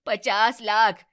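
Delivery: "पचास लाख" punched out loud; emphatic. Acted. Mother-in-law rubs the dowry sum in the bride's face, loud stress on "पचास लाख". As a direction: hammer home "पचास लाख".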